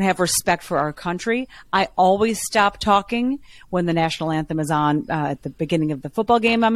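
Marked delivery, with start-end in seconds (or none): none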